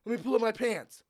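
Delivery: high, loud retold plea; imitating drunk customer. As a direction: plead like the stalling drunk being dragged out. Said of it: "He's like".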